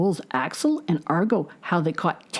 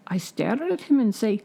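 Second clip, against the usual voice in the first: plain reading voice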